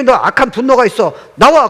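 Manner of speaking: preacher voicing the Lord, loud and commanding; commanding shout. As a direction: bark the command like the Lord calling.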